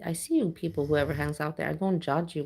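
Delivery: ordinary talk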